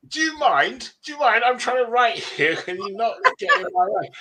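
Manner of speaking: high, loud, exasperated protest; comically affronted, pleading for room; mock indignation. Struggling writer protesting at rival books, comically indignant.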